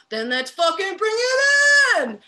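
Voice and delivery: loud, high, drawn-out rallying shout; slow shout. Acted. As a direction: bellow it to pull everyone into a hug. Inmate calls everyone to hug.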